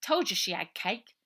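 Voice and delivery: put-on high, loud boy yelling get off; an irritated kid shoving someone away; cross young boy. A narrator's reading. Robert's voice.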